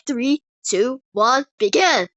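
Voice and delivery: loud count with a punched final "begin"; fight countdown. Acted. Giant counts down the fight, loud and forceful. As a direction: call each number out like a match starter. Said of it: "counts down on the start of the fight".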